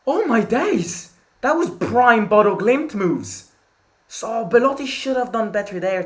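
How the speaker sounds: high, loud, thrilled exclaiming; real amazed shouting. Real, not acted. Watching fan marvels at prime Bodo Glimt moves, amazed shout. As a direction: shout it in sheer awe.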